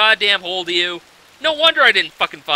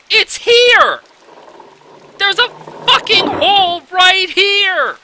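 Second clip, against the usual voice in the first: high, loud outraged yell, slowing at the end; furious shout; 11 semitones higher, 5 dB louder, slower than usual. Real, not acted. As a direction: scream it at the screen in outrage. Angry discovery of hidden hole.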